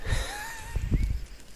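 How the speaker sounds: very high, breaking giggle; high squeaky laugh. Real, not acted. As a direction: crack up mid-sentence. Cracking up while reading.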